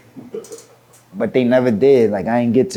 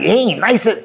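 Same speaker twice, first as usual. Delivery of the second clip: higher-pitched, scoffing put-down; retold dismissiveness; 6 semitones higher than usual. Star sizing up the small newcomer, dismissive. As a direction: imitate someone belittling a challenger. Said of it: "He start talking like that".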